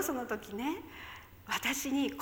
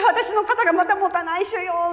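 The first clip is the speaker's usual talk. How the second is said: strained plea; 5 semitones higher, 8 dB louder than usual. Retold or imitated. Straining arm pleading to God.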